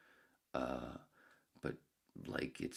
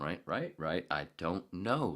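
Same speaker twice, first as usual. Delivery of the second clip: ordinary talk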